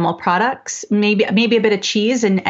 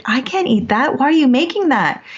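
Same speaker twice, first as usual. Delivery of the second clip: put-on higher, whiny upset child voice; upset crying kid; 6 semitones higher than usual. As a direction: play the kid close to tears over dinner. Son upset, crying at dinner.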